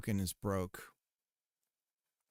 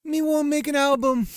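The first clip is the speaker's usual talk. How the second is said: much higher, louder fake Jamaican patois; mock Jamaican accent; 13 semitones higher, 10 dB louder than usual. Streamer retells Snow pitching a reggae album, hammed-up Jamaican accent.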